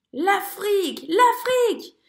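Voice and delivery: crier l'Afrique, plus haut et plus fort; cris de joie. A narrator's reading. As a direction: crier de joie en voyant la côte. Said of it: "les cris de Zigomar". (merle qui crie de joie)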